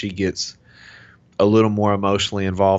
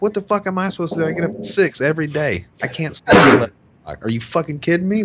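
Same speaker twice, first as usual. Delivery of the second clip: fast retold outburst, much higher pitch; indignant rant; 10 semitones higher, 4 dB louder, faster than usual. Husband who gets up at six reenacting his protest, indignant. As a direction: protest in heated disbelief, words tumbling out. Acting out his angry retort.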